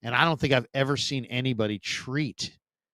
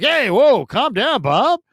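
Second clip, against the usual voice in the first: higher, louder mock soothing of an overexcited caller; imitating Gary calming a caller; 10 semitones higher, 6 dB louder, slower than usual. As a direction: play the host settling down an amped-up caller. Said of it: "he'd be like".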